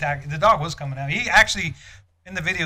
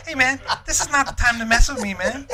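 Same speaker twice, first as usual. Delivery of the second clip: annoyed protest; 10 semitones higher, 5 dB louder than usual. Retold or imitated. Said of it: "I'm like".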